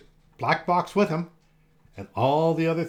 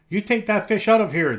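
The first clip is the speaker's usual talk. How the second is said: louder angry shout; yelled; 6 semitones higher, 6 dB louder than usual. A narrator's reading. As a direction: chase them off, annoyed. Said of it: "they yelled".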